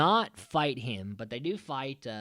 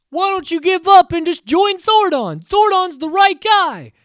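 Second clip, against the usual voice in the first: earnest hero voice; 14 dB louder than usual. Retold or imitated. Jason urging Tommy to join Zordon, high hammed-up heroic plea. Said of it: "Jason is like".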